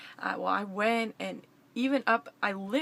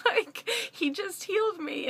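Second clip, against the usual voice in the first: high, breathless with joy; almost squealing the news; excited; 9 semitones higher than usual. Retold or imitated. To the stranger, overjoyed and high. Telling a stranger about healing.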